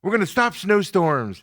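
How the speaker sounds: hammed-up high, loud Trump impression, promising sunshine; mock Trump. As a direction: puff up like a showman promising the moon. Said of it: "he's all".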